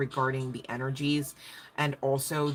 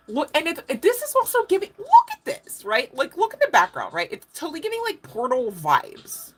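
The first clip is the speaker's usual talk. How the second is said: much higher and louder, thrilled; thrilled excitement; 13 semitones higher, 8 dB louder than usual. Real, not acted. Pointing viewers to the background, thrilled. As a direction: gush about what you see.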